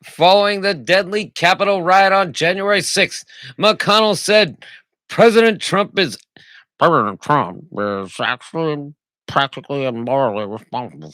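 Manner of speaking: booming high news read, mocking; loud mock news read. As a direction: read the headline like a loud announcer.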